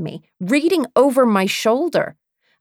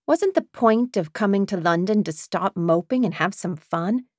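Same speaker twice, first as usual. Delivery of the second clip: ordinary delivery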